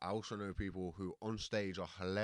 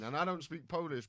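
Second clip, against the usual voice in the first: ordinary talk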